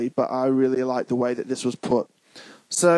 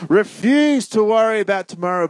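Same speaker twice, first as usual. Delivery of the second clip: raised, punchy preacher's command on REFUSE; emphatic; 6 semitones higher, 5 dB louder than usual. Shown as a demonstration. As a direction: REFUSE hit loud and high.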